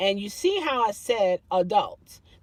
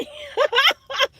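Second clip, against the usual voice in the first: high laugh; 4 dB louder than usual. Real, not acted. Laughing at her own doubt.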